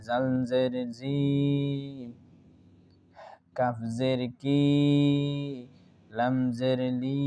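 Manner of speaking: slow letter-by-letter recitation, long held "জি", "কি", "লি"; drawn-out spelling. Shown as a demonstration. Teacher showing pupils how to read the letters, slow drawn-out spelling. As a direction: stretch each syllable, as in "নুন জের নি", pausing between sets. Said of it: "এইরকম পুরা পড়িয়ে দিবা".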